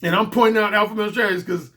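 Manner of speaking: ordinary talk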